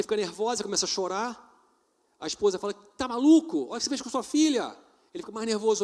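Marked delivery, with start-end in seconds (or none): 2.7-4.8 higher and louder, scolding; retold furious wife; mock scolding wife. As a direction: play the angry wife telling him off. Wife scolding husband in story.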